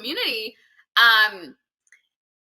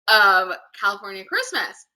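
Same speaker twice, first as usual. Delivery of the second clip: ordinary talk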